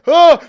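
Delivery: loud, high startled cry; startled shout. Retold or imitated. Roommate caught in the act, startled shout. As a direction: yelp like you've been caught. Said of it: "and he's like".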